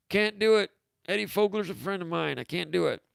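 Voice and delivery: lightly voiced old coach, flat refusal; a hint of a stern coach saying no; bad Heathcote impression. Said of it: "It's a bad Heathcote impression".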